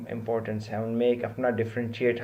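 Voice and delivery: ordinary talk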